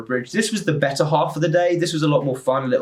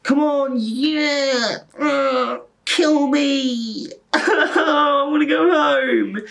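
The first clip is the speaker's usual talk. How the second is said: high, drawn-out fake cheer turning to a wail; worn-out cheering; 13 semitones higher, much slower than usual. Tired extra cheering the twenty-fourth take, high despairing mock cheer. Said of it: "you see it for the twenty-fourth time".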